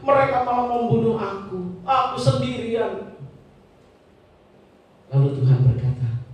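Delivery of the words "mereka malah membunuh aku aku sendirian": high-pitched, self-pitying complaint; whining Elijah. Acted. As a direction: plead to God like a worn-out prophet.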